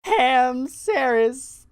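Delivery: high, slow, drawn-out mock announcement; imitated goofy proclamation. Buddy cracking up and christening his friend, mock proclamation. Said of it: "he just breaks, and he's just like".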